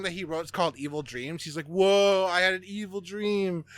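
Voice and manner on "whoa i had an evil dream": hammed-up overacting. Imitating him quoting his own song, hammed-up overacting. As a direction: overact it like an unhinged movie star. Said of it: "Nick Cage levels of fucking acting".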